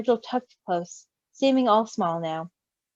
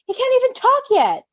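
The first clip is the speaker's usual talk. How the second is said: much higher pleading protest; 12 semitones higher, 5 dB louder than usual. A narrator's reading. Patton protesting to Roman, high and pleading. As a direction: keep pushing the protest, high. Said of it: "Patton protested".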